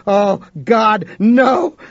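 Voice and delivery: high, loud cry of dawning horror; panicked shout; horrified cry. A narrator's reading. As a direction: burst out in terror. Sudden horrified realization.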